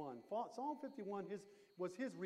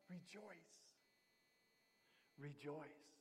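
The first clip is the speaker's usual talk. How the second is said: soft echo; 5 semitones lower, 13 dB quieter, slower than usual. Acted. Soft echoed exhortation after point.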